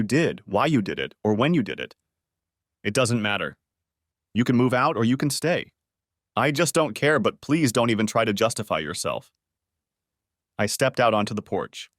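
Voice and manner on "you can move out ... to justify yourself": usual speaking voice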